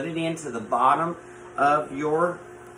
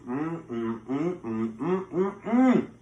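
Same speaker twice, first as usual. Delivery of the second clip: savoring hums; 3 dB quieter, slower than usual. Real, not acted. Tasting the fried taters.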